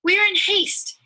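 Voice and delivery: high, loud urgent cry. Acted. The women tell the ruffians to let them pass, loud high cry. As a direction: push past them with an urgent shout.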